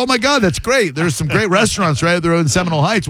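high-pitched chatty enthusiasm; retold gushing. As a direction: gush as if bonding with a stranger. Retells his gushing reply to caller.

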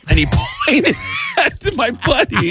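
high, loud voice breaking into laughter; real laughter pushing the voice up; laughing. Real, not acted. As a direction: crack up while telling the punchline. Teller cracks up at his punchline.

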